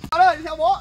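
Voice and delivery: calling out. Acted. Father calls the child.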